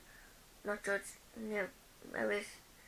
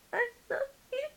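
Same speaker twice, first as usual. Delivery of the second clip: very high, sobbing love you; mock hysterical crying; 5 dB louder than usual. Fan telling her sister she loves her, sobbing very high. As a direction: squeak it out through fake tears. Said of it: "I was crying hysterically".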